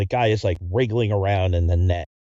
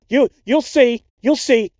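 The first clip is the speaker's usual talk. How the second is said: high, loud yelling threat; shrieking threat; 14 semitones higher, 7 dB louder, slower than usual. Acted. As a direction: scream it like a man unhinged. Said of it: "goes back to yelling about getting".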